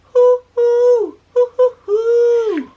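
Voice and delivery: high, loud, drawn-out owl hoo; owl hoots. Retold or imitated. Imitating the owl for the viewers, hoo hoo. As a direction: hoot like a real owl, slow and high. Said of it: "like that".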